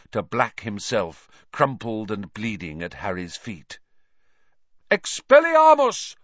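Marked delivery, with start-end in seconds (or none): 4.9-6.2 high, loud spell cry; shouting a spell. A narrator's reading. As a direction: fire off the spell. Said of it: "Lupin shouted".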